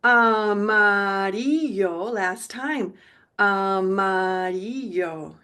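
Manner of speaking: Amarillo drawn out slowly; slow teacher demo of Amarillo; slow model word. Shown as a demonstration. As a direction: stretch Amarillo so learners hear each syllable.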